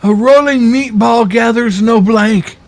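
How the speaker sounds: high-pitched mock mob boss; Godfather. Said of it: "The Godfather said".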